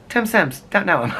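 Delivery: high-pitched quoted comeback; imitated movie quote. Friend answering on the phone with a movie line, imitated high. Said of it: "And he would go".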